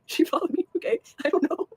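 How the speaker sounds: high, loud, helpless giggly protest; real giggling fit. Real, not acted. Vlogger explaining an obsessive follower, in a laughing fit. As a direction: explain through bubbling laughter.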